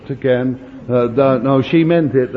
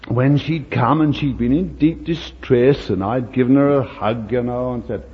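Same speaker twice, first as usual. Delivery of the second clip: ordinary delivery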